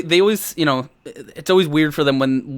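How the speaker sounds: ordinary delivery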